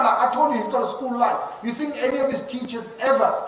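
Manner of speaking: ordinary talk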